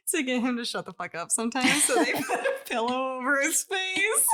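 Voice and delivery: giggling through the words; words breaking into laughter; laughing. Real, not acted.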